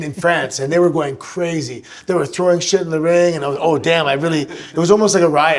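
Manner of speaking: ordinary talk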